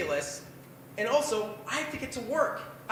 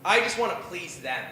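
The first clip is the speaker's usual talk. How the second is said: usual speaking voice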